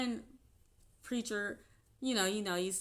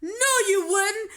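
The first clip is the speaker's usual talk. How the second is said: mock preacher through laughter; 9 semitones higher, 10 dB louder than usual. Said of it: "He's like".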